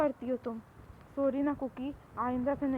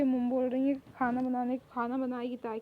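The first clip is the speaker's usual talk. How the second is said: ordinary talk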